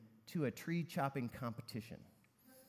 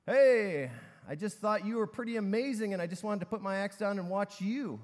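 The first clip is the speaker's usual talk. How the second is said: high, raised voice answering across a distance; calling back; 6 semitones higher, 6 dB louder than usual. Acted. Scrawny guy answers from the shade, calling back high and loud. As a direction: call back, starting loud and easing a little.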